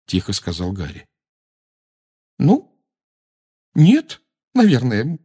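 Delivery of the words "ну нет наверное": high, slow, emphatic annoyance; an exasperated, higher-pitched no; cross and tearful. A narrator's reading. Girl wiping her eyes answers her friend, crossly. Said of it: "сердито вытирая глаза".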